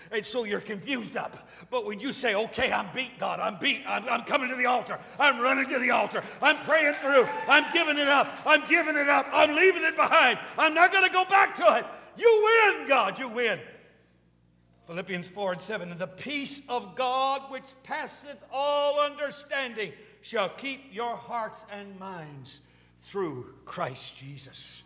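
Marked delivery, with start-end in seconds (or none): 2.3-13.6 building from firm to a high, loud preaching shout; impassioned altar cry climbing to a peak; building surrender cry. Acted. 15.9-24.8 stately verse with long-held words; scripture recitation. Acted.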